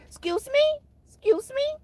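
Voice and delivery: very high, squeaky rising question; hammed-up offended squeal, twice; Tracy. Imitating how Tracy would say it, high and offended. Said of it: "like Tracy would say".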